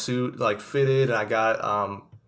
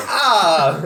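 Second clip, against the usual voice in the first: mock chef exclamation; 6 semitones higher, 9 dB louder than usual. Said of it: "he's like".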